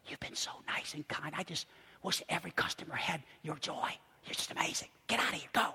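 hushed, breath-only confiding; very soft, secretive whisper; conspiratorial whisper. Retold or imitated. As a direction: whisper a secret thank-you and send-off. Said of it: "he leans over and he whispers".

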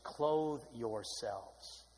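very slow, soft, low CLOTHE; preacher softly repeating the verse to the hushed room, very slow; hushed refrain. Acted. Whispery-soft slow refrain.

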